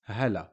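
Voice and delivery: "hala" with a short a after the L; fatha on the lam. Shown as a demonstration. Teacher contrasting fatha with sukoon, added final a. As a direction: open the L of "hala" into a second syllable. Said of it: "with a fatha on the lam".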